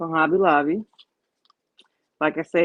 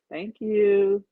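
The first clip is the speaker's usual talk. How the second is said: ordinary talk, nothing marked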